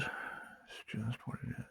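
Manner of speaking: slow breathy whisper of the phrase; whispered mantra. Acted. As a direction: breathe it out like a secret.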